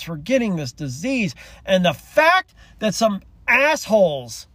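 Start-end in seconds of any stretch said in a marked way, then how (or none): 1.6-4.5 real rising anger, key words punched high and loud; indignant, leaning hard on the insult; a little angry. Real, not acted. Angry on behalf of sick kids, a little heated. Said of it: "I'm gonna get a little angry about this".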